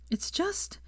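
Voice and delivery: high, breaking voice; voice cracking. A narrator's reading. Hermione starting to explain to Draco, voice cracking. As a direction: let the voice crack on it. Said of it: "her voice cracked".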